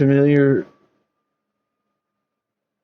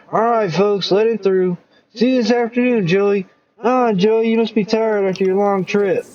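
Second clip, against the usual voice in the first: much higher jovial calls; higher cheerful crowd call; hearty mayor; 8 semitones higher than usual. Acted. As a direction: clear the way cheerfully.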